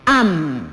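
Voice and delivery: am said alone and low, a clear open a then m; teacher showing a syllable; syllable demo. Shown as a demonstration. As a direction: sound out am slowly. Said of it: "se pronuncia la sílaba".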